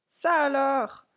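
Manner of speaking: high, slow exclamation; cried out in surprise. A narrator's reading. Clown amazed by his visitors, crying out in surprise. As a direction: draw out the astonishment. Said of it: "s'écria-t-il".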